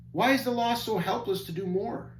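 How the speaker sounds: high, wondering question; puzzled question. Acted. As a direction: ask it a bit puzzled, rising high. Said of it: "a bit puzzled".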